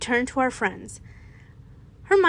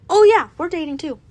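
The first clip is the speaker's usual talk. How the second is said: brighter and louder, eager; excited reveal; 4 semitones higher, 6 dB louder, faster than usual. A narrator's reading. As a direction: blurt it out happily. Couples reveal together, excited.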